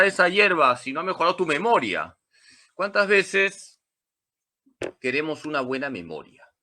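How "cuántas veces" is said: ordinary talk, nothing marked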